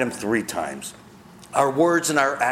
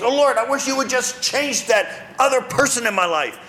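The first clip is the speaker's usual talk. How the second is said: higher, pleading voice of a complaining believer; mock whiny prayer; 10 semitones higher than usual. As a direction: play the self-pitying pray-er asking God to fix others.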